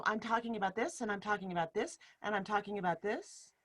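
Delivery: each "this" lifted slightly at the end, not a question; rising list tune. Shown as a demonstration. Coach showing a rising sentence ending to a video call group. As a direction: keep the pitch up on every "this". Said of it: "end on a little farther up".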